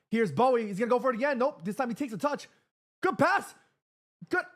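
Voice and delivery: high, rising play-by-play excitement; excited commentary. Real, not acted. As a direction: call the attack as it builds. Live match chance unfolding.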